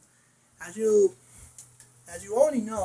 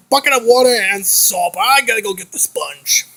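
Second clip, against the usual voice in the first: ordinary talk, nothing marked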